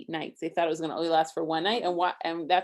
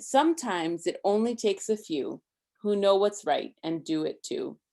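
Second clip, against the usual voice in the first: lively rhyming read-aloud, a bit higher and louder; story reading; 3 semitones higher, 7 dB louder than usual. A narrator's reading. Parent reading a Hanukkah book to Isaac, lively rhyme. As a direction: read the rhymes to a child with bounce.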